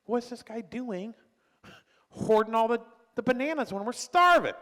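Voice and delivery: high, loud outraged cry over the hoarded bananas; indignant outrage. Retold or imitated. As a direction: play a starving person yelling at a greedy neighbour. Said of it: "you'd be like".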